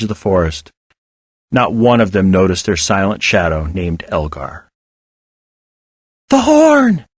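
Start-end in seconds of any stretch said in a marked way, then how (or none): 6.3-7.1 excited. A narrator's reading. Excited exclamation.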